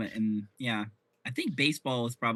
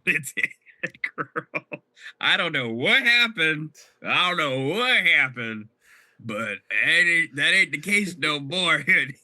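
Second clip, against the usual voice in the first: sassy drawl; 3 semitones higher, 7 dB louder, slower than usual. Acted. Sassy confession after turning forty.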